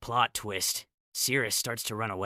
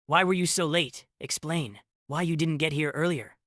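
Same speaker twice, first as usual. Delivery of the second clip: raised, higher-pitched scolding; 6 semitones higher, 4 dB louder than usual. A narrator's reading. Queen berating the late king, scolding. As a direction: scold him with rising volume and pitch. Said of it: "She continues scolding him".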